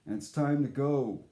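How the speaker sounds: ordinary delivery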